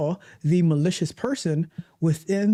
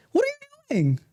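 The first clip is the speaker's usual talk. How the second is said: shocked outrage. Retold or imitated. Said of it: "do you say".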